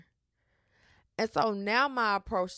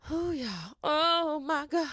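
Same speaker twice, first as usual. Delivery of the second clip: higher, exasperated groan of disbelief; exasperated exclamation; 8 semitones higher, slower than usual. Real, not acted. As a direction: throw up your hands in disbelief.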